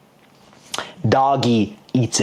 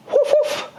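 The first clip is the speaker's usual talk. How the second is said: mock yelp of a little dog; high dog woof. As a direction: bark it like a tiny doggy. Imitates dog bark.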